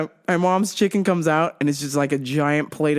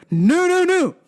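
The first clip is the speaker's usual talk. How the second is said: high protest easing into sing-song fussing; hammed-up dad refusal; insistent dad; 4 semitones higher than usual. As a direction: imitate dad digging his heels in. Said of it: "he's like".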